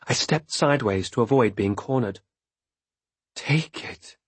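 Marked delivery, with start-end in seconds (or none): 3.3-4.2 airy breathy whisper. A narrator's reading. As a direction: breathe the words out. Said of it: "she said in a breathy voice".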